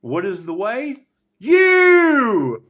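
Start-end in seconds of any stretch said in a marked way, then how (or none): 1.4-2.7 much louder and higher, a single punched word; triumphant yell of You; emphatic shout. Real, not acted. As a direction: point right at the listener. Answering own question emphatically.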